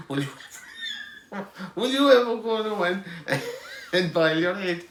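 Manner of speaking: loud, high, laughing put-down; laughing scold. Retold or imitated. Mum laughing at the son's demand, loud mock scolding. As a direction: scold him while cracking up.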